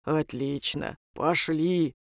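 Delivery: demon's bass, quietly pleased. A narrator's reading. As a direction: agree contentedly in a deep voice. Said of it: "обрадовался он".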